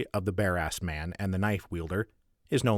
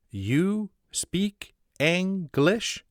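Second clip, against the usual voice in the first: very slow, high, hopeful question; hopeful echo; 11 semitones higher, 3 dB louder, much slower than usual. Acted. Sparks echoing the footman's question, very slow and hopeful. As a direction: plead the question back, stretched out.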